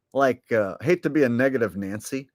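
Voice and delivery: ordinary talk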